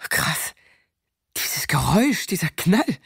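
put-on hushed, stunned boy's voice; awed friend. Mike stunned by the gunshot, hushed whisper. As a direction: breathe it out in shock.

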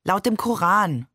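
ordinary delivery, nothing marked

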